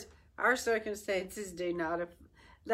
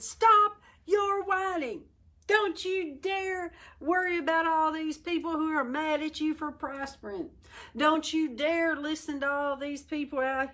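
higher, forceful preaching rebuke; fired-up rebuke; 5 semitones higher than usual. Acted. Preacher voicing Nehemiah telling the people to stop whining, fired up. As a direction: scold them into courage.

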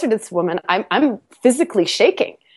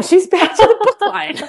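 high, breaking into laughter; laughing; 9 semitones higher than usual. Real, not acted. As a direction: let the laugh take over the line. Laughing at her own story.

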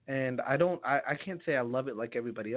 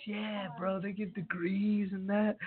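lightly imitated bro, pitched up; buddy's voice; 7 semitones higher, slower than usual. Buddy confirming that degrees exist, lightly imitated bro voice. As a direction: play the casual buddy, higher and drawn out. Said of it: "And he goes".

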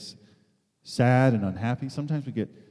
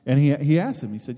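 normal talking voice

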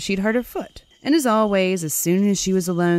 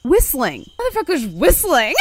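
higher, louder mock outrage; indignant outburst; 7 semitones higher, 4 dB louder than usual. Real, not acted. The teller outraged that the goodman whistles, loud indignant cry. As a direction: howl it in disbelief, raised and loud.

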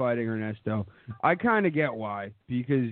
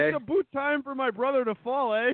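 mock Canadian with "eh?"; Bret Hart impression; 8 semitones higher than usual. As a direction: ham up a Canadian accent, higher. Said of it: "that's a Bret Hart impression".

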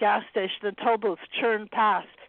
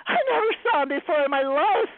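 cried out; 7 semitones higher than usual. A narrator's reading. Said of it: "cried Anna".

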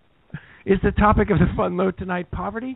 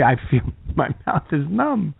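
ordinary delivery

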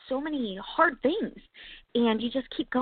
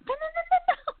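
urgent no; faster than usual. Retold or imitated. God voiced stopping a quitter.

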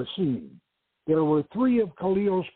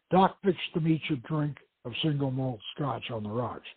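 ordinary delivery, nothing marked

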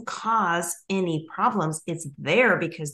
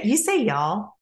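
mock Southern y'all; 5 semitones higher than usual. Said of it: "We do talk like that".

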